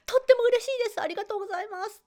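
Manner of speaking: 高い声で弾むように感謝; 喜ぶ生徒を軽く演じて; 嬉しそうな生徒. Retold or imitated. 受講生の感謝を再現. (高い声で軽く喜ぶ生徒)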